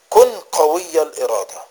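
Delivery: preaching with firm, driving emphasis; forceful exhortation. Real, not acted. Preacher urges listeners to resolve on repentance, forceful exhortation.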